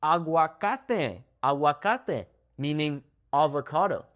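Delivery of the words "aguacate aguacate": aguacate slow, a-gwa-KA-te, open pure vowels; slow model aguacate; careful Spanish pronunciation. Shown as a demonstration. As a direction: say aguacate syllable by syllable. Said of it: "how about the word".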